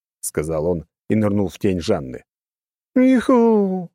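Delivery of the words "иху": high, loud happy yelp; joyful whoop. Acted. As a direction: whoop with joy. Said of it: "обрадовалась блондинка".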